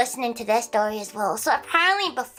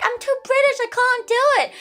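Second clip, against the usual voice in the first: much higher, whiny mock British protest; mocking the British guy; 13 semitones higher, 4 dB louder than usual. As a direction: imitate the shy guy squirming out of it. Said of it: "He's like".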